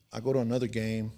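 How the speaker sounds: ordinary talk, nothing marked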